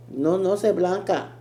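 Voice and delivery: loud, high mock Puerto Rican accent; a playful go at a Puerto Rican accent. Mocking Jocelyn's claim in a Puerto Rican accent. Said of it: "Puerto Rican accent".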